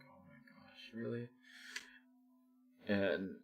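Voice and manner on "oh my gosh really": breathless, shocked whisper of disbelief; half-voiced gasp of upset disbelief; whispering in stunned disbelief.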